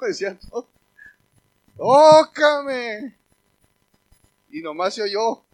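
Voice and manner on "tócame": loud, high pleading cry; retold shout. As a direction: belt it out, begging to be touched.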